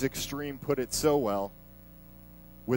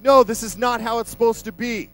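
higher and louder, insistent protest; adamant; 6 semitones higher, 5 dB louder than usual. Retold or imitated. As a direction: plead the rules, pushing hard. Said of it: "But Peter's so adamant".

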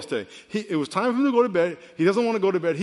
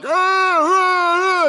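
high loud reenacted scream of pain from the bench; retold pained scream; 13 semitones higher, 8 dB louder, much slower than usual. Preacher reenacting his bench press injury with a loud pained scream. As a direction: yell it out like a torn pec. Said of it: "And I screamed".

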